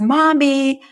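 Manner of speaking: put-on high, loud, slow child offering a gift; excited little girl. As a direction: sing it out like a proud kid. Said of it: "And she says".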